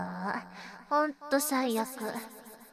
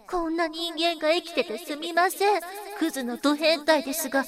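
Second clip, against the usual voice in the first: higher, louder, quickening grovelling plea; mock pleading apology; 6 semitones higher, 5 dB louder than usual. Scripting the listener's grovelling apology, mock pleading. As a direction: ham up a desperate begging apology. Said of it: "って言えよ。".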